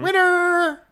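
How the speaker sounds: high, punchy game-show "Winner!"; a sudden high announcer call; mock announcer shout. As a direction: crow it like a prize announcer. Clark Griswold parody, announcer shout.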